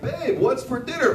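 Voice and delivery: high, loud call right behind her; husband asking his wife about dinner. Retold or imitated.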